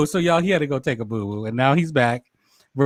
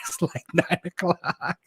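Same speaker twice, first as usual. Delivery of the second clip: laughing; 2 semitones higher, 8 dB quieter, slower than usual. Real, not acted. Laughing about Una's bedtime.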